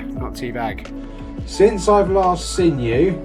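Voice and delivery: ordinary talk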